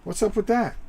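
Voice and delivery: high, loud startled yelp of a toy soldier; hammed-up surprised cry; startled figure. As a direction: jump back in alarm at the attacker. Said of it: "this guy just turns around and goes".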